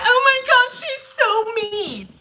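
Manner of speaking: mock-offended whine that trails off slow; mock victim whine. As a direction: play the wounded crybaby. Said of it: "They be like".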